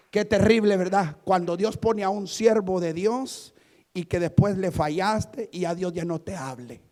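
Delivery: normal talking voice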